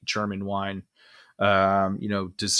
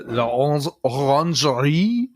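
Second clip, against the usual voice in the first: put-on French accent; 4 semitones higher, 3 dB louder than usual. Shown as a demonstration. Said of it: "that's my amazing French accent".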